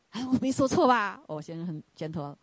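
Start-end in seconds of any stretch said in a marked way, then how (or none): 0.1-1.2 raised, louder playful challenge; husband teasing his wife, raised and playful. Retold or imitated. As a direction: needle her good-naturedly. Husband teasing after apology.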